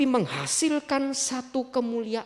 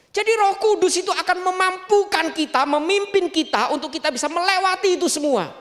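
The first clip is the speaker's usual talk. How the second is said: full-force preaching; 3 semitones higher, 5 dB louder than usual. Acted. Pastor promising the Holy Spirit's help, loud preaching. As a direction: press the comfort hard on the listeners.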